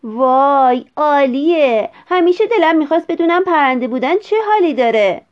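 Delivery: high, loud whoop of delight; loud, elated exclamation; excited shout. A narrator's reading. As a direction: shout with a thrilled boy's joy at flying. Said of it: "ویلیام فریاد زد".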